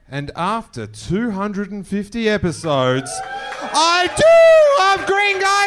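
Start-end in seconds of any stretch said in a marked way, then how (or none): 3.2-5.7 high, loud, stretched-out yell; long hollered show slogan; catchphrase shout. Real, not acted. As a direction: bellow the show's name to rev up the crowd. Host yells the show's catchphrase.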